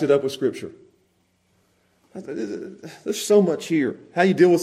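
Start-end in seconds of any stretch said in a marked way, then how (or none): none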